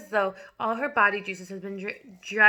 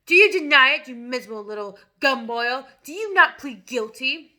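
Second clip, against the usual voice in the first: ranting tyrant headmistress; 4 semitones higher, 10 dB louder than usual. A narrator's reading. Headmistress denouncing the boy to the whole school. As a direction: spit every insult at him. Trunchbull tirade.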